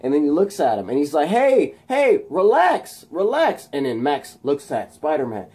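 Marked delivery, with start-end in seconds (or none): 1.3-3.7 high, loud calming shout; imitated hero's raised voice; acted urgent calling. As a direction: call out to settle someone down. Spider-Man calling to calm Electro.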